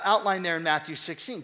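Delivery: ordinary talk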